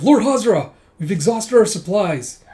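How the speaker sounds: high, loud soldier's call easing down; urgent report. Retold or imitated. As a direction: report bad news urgently to your lord. Retold soldier's alarmed report.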